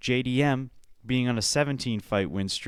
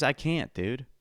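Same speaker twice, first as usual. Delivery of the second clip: ordinary talk, nothing marked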